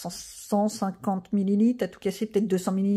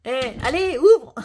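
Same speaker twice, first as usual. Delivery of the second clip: loud command to the dog, ouvre rising to a shout; telling the dog loudly to get out; calling the dog; 3 semitones higher, 6 dB louder, slower than usual. Real, not acted. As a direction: snap at the dog to go out. Ordering the dog out.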